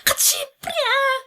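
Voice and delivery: high, slow, overjoyed cry; tearful delight. Acted. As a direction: squeal yes through happy tears. Employer accepting proposal, crying.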